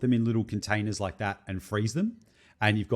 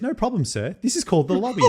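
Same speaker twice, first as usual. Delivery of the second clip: high, sweet mock receptionist voice; polite receptionist; 9 semitones higher, 4 dB louder than usual. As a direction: play the overly polite hotel clerk. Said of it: "the lady at reception says".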